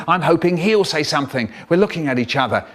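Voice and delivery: ordinary delivery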